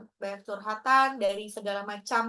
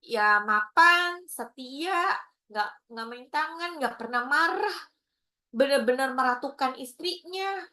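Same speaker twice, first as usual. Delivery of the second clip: normal talking voice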